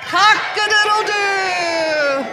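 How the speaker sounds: high, slow, shouted crow with a laugh; proud crow. Pretend. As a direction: belt it out joyfully. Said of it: "because he felt so good saying it".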